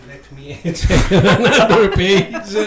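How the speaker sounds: chuckling while shuffling notes; laughing. Real, not acted. Host to guest while checking notes, laughing.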